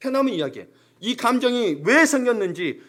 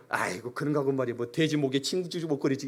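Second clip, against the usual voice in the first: sarcastic; 5 semitones lower, 7 dB quieter than usual. Retold or imitated. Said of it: "비꼬죠".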